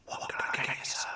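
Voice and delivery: hushed, toneless whisper. Acted. Shopkeeper asking the customer what he wants, whispering. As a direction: whisper it like a secret. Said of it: "You're whispering for".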